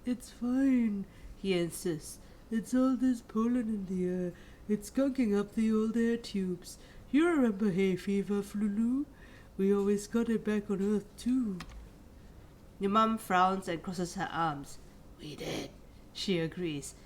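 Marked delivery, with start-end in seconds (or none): 0.1-11.6 lightly voiced dad brushing off a cold; a hint of a stuffy, insistent father; sick dad voice. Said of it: "I don't know how to do the guy's voice". 15.2-15.7 soft breathy aside; whispered agreement. Acted. Mum agreeing, unvoiced.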